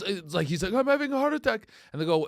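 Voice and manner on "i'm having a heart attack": high-pitched mock alarm; high, worried quote; panicked man. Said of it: "He, he goes".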